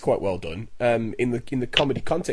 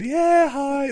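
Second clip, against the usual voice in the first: much higher fake-polite voice; mock strained niceness; forced friendly hi; 14 semitones higher, 4 dB louder than usual. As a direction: greet through forced politeness. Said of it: "like this".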